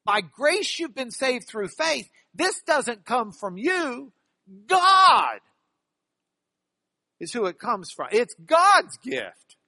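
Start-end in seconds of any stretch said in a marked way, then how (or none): 0.0-5.4 higher voice, building to a loud, drawn-out "God"; building emphasis. Shown as a demonstration. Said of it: "We lose the emphasis". 8.1-9.6 "God's" high, loud and stretched; stress on GOD'S. Shown as a demonstration. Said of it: "really bear down on the fact".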